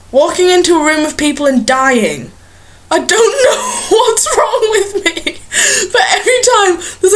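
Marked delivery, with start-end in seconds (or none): 2.9-7.1 high, giggly and breaking up; can't get through it for laughing; laughing while talking. Real, not acted. Vlogger laughing at her own strange urge.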